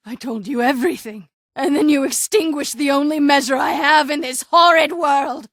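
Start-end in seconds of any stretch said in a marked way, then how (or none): 1.6-5.5 raised, wounded voice; aggrieved and loud; bitter loud accusation. Acted. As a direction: hurl the blame at them. Bitter accusation after loss.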